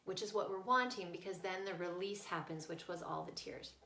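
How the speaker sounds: ordinary delivery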